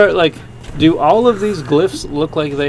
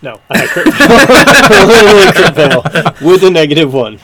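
laughing at a crit fail; 4 semitones higher, 10 dB louder than usual. Real, not acted. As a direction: crack up over the bad roll.

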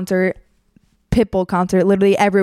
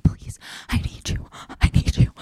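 breathy, voiceless mock begging; whispered pleading; 6 dB quieter than usual. Guy begging her to talk, whispered pleading. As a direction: imitate a desperate whisper. Retold desperate plea from the boy.

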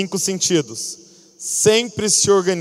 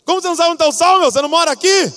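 high, loud exasperated father shouting at his son; furious dad bellowing across the table; angry father yelling; 10 semitones higher, 5 dB louder, much faster than usual. Retold or imitated. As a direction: blow up at the kid who can't find the salt. Father yells at lazy son.

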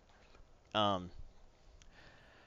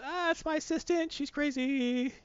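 much higher, slow squeaky admission; nervous Normie; 12 semitones higher, 3 dB quieter, slower than usual. Retold or imitated. Normie blaming his assistant, high and nervous. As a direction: squeak out the excuse. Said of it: "who is?".

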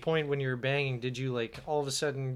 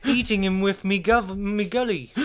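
a hammed-up English accent, slightly higher; 4 semitones higher than usual. Friends asked him for an English accent, so a hammed-up English accent. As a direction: play a cheeky English bloke. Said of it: "can you do an English accent".

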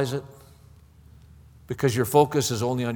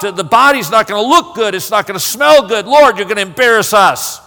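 loud, agitated objection, building to the end; indignant protest; 6 semitones higher, 7 dB louder than usual. Acted. Martha objects to Jesus taking the stone away, loud and indignant. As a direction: protest forcefully, piling up the reasons.

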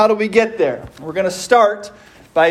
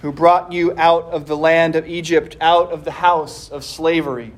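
solemn scripture reading; 4 semitones lower than usual. Acted. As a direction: read it with reverent weight. Reads Scripture opening the sermon.

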